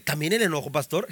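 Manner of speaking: low, soft aside as a church member; doubting congregant. Acted. Congregant querying the pastor about anger, low and soft.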